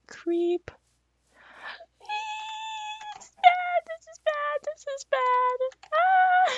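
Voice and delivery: very high frightened wail; panicked. Real, not acted. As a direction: squeal in panic as it comes closer. Creeper approaching.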